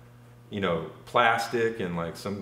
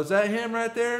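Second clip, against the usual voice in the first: mock high woman's voice; 13 semitones higher than usual.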